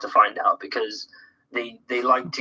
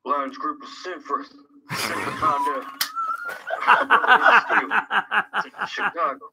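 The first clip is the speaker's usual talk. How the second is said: [name]; 9 semitones higher than usual. Acted. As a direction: put on a hushed singer-idol voice. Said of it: "I'll do it in the King instead".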